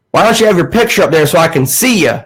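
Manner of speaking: nosy Southern caller, mimicked; Southern accent. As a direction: imitate a demanding old Southern caller. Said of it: "he had a southern accent".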